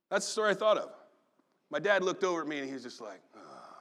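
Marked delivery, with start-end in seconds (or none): 3.3-3.7 angry grunt. Retold or imitated. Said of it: "God is about to explode in anger".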